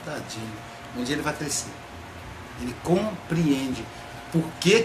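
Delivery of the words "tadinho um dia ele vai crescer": much softer, lower, gentle; tender pity. Retold or imitated. God looking down at people, soft tender pity. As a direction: say it like a fond parent over a child.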